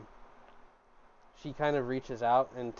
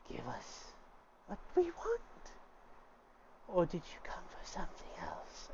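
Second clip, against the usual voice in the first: high, eerie, half-whispered otherworldly voice; a spirit speaking through the girl, hushed and strange; possessed spirit voice; 9 semitones higher, 6 dB quieter than usual. Acted. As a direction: ghostly whisper riding up high. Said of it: "and it says".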